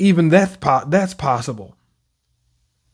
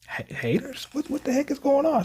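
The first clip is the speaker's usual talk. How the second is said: confused high disbelief; 9 semitones higher, 9 dB quieter than usual. Real, not acted. Confused by the scene.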